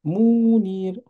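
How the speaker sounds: "Mounir" slowly, with long ou and long i; drawn-out vowels. Shown as a demonstration. Teacher correcting a student's vowel lengths, prolonged vowels. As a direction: hold both vowels of "Mounir".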